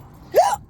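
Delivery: sharp high hiccup gasp; sudden squeaky hiccup. Real, not acted. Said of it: "I'm still got the hiccups".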